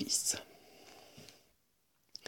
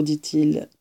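ordinary delivery

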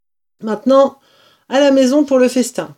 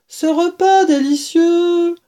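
grand opening; 9 semitones higher, 4 dB louder than usual. Acted. Mr Fox starts grand speech.

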